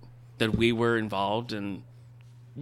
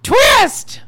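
dramatic exclamation; 13 dB louder than usual. Acted. Dramatic reveal in the sketch.

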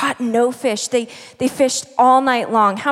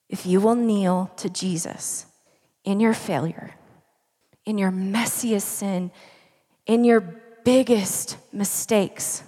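softening, stressing will each time; preaching appeal. Acted. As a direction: plead with them gently.

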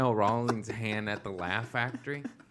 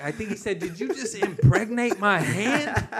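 a touch higher, slow and laughing; mock outraged comic; 8 semitones higher, 6 dB louder than usual. A comic retells the handshake, mock outraged and slow. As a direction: play the disgusted comic, half laughing. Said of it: "He's like".

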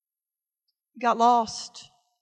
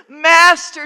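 second Master pitched higher and louder; crying out higher; 5 semitones higher, 10 dB louder than usual. Acted. Disciple in the storm crying out higher, desperate. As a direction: cry it again, more desperate. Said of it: "you've cried out".

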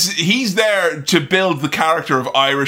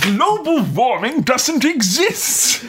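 high, drawn-out singsong put-on voice; mock rant; 7 semitones higher, slower than usual. Man lecturing a woman to listen, high mock rant. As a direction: swoop up high and drag the words out.

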